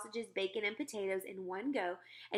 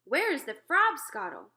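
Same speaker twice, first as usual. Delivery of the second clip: loud, higher demand; giant demanding; 6 semitones higher, 9 dB louder than usual. Acted. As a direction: call out for the drink. Giant demanding his drink.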